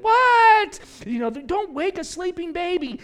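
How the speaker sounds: high, loud mock outrage; shrieking at the idea of waking the baby; Mary's hammed-up cry of alarm. Mary hushing Joseph over baby.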